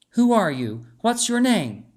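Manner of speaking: lightly voiced nurse demanding answers; mild demand. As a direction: press him for a name. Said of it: "demanded Yuna".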